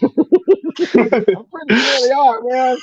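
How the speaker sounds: talking through real laughter, high-pitched; laughing. Real, not acted. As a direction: let the laugh stretch and lift the words. Laughs at own vampire theory.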